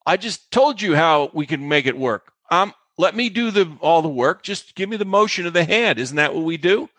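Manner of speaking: re-explain it as if fed up; retold exasperation. Actress answers co-star who asks how it works, exasperated. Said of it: "it was infuriating".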